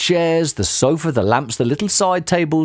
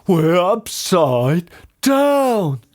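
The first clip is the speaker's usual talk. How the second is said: slow, raised-pitch astonishment; shocked gasp; 5 semitones higher, much slower than usual. A narrator's reading. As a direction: gasp it out, stretching the words. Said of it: "gasped Mister Twit".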